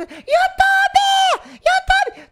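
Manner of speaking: high, loud yelling of YouTube; imitated shopping crowd shouting. Fan in a mall yells at Gabi Fadel, shrieking. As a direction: yell it across the mall like a fan. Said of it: "Só que".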